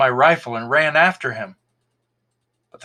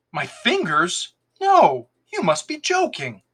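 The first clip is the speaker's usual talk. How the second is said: indignant, leaping high on no; offended protest, pitch jumping up; angry; 7 semitones higher than usual. A narrator's reading. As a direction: refuse him with open irritation. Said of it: "My voice didn't hide my anger".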